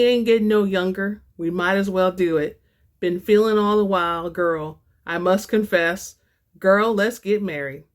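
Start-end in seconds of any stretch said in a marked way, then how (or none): none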